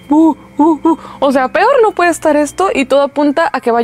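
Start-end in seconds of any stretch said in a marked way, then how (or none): 0.1-1.0 light hooting owl sound; owl hoot imitation. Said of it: "se escucha como que".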